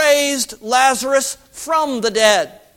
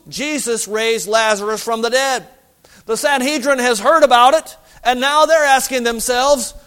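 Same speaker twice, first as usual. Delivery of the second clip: usual speaking voice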